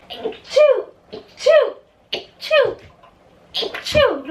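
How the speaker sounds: loud high sneezing fits; real sneezes. Real, not acted. Speaker sneezing mid-story, loud real sneezes. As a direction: sneeze out loud, one after another. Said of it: "I'm gonna sneeze".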